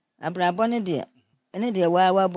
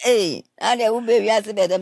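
louder, pressing mock question; mother's raised question; 3 semitones higher, 10 dB louder than usual. Mother questioning her daughter, retold in a raised voice. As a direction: demand an answer like a worried mother.

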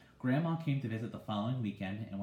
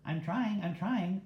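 high parroting bird voice, much higher; bird mimicking; 9 semitones higher, 4 dB louder, slower than usual. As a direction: echo it like a mynah bird. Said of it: "Uncle Feather repeated".

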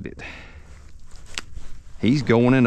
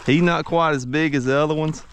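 real giddy excitement, pitch far higher; excited. Real, not acted. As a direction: sing out each catch in a bright high voice. Another fish on the line.

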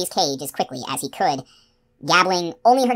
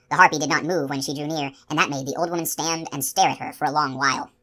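plain reading voice

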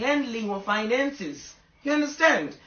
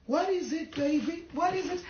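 higher, softer doting voice; fond talk to dog; 8 semitones higher, 5 dB quieter than usual. Real, not acted. Asking her dog what it wants, higher doting coo.

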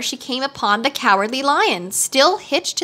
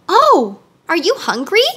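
put-on high, raised-pitch little-girl surprise; high exclaimed "Oh", then rising question; surprised question; 7 semitones higher, 5 dB louder than usual. A narrator's reading. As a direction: ask it with sudden wide-eyed interest. Dorothy voiced, surprised question to tiger.

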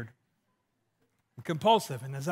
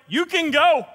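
high, louder mock voice; a bit higher and louder, playing her; imitating her; 7 semitones higher, 6 dB louder than usual. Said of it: "be like".

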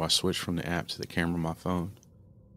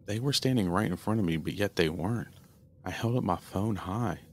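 ordinary delivery, nothing marked

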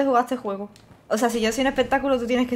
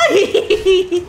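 high laugh; 8 semitones higher, 8 dB louder than usual. Real, not acted. Laughing at game mishap.

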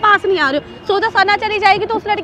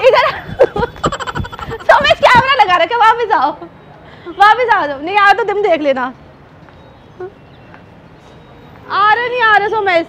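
calling after him; 3 semitones higher, 4 dB louder than usual. Acted. Girlfriend calling her fleeing boyfriend back in a prank, loud mock calls.

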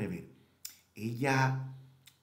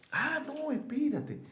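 surprised; slower than usual. Real, not acted. Catches own mistake.

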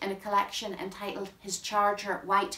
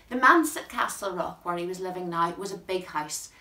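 ordinary talk

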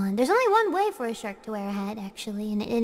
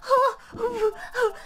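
high hammed-up sobbing; fake crying; 12 semitones higher, slower than usual. Streamer fake-sobbing over being stuck, hammed-up crying. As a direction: pretend to cry, high and whiny.

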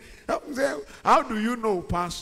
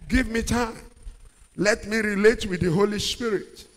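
usual speaking voice

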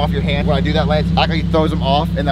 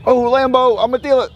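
excited about the car; 9 semitones higher than usual. Shown as a demonstration. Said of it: "and then go".

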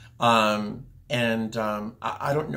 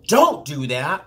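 loud, higher mock yell; retold yell; 4 semitones higher, 8 dB louder, slower than usual. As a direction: call it out sharply, imitating the medic.

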